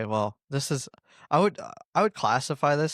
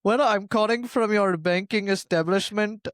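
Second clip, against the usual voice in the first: put-on higher Indian-accented call center voice; Indian telemarketer accent; 5 semitones higher than usual. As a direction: play an Indian phone scammer. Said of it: "Indian telemarketer".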